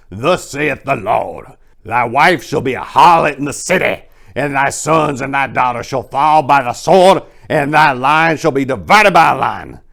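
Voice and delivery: put-on loud, high preaching old man; fiery old man quoting Amos; old preacher. A narrator's reading. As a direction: thunder the scripture like a street preacher. Old Harding preaching scripture.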